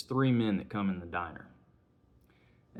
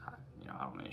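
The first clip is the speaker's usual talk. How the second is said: barely voiced, hushed and unsure; evasive whisper; 13 semitones lower, 12 dB quieter, much faster than usual. Husband dodging his wife's question about the stranger, whispering.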